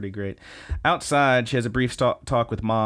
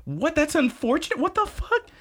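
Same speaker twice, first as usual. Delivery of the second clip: high squeaky outraged mom; shocked mom. Retold or imitated. Mom hearing her daughter is fine, high outraged squeak. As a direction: squeal it in disbelief like the mother. Mom reacting in retold scene.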